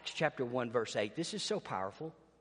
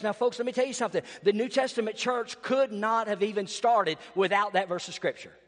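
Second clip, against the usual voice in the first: higher, louder sermon climb; full-force preaching; 5 semitones higher, 5 dB louder than usual. Acted. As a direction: drive the congregation with rising power.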